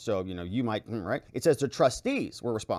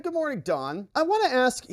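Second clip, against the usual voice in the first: a lightly voiced sugary lawyer, higher; mock lawyer; 11 semitones higher than usual. Lawyer greeting Don Junior on the stand, mock sweet.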